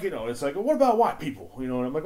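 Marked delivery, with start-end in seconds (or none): 0.6-1.6 much higher, louder cartoon zebra punchline; Chris Rock voice. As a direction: belt it up high like a stand-up comic playing a zebra. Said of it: "I can't do a good Chris Rock impression".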